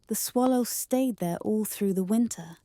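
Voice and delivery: ordinary delivery, nothing marked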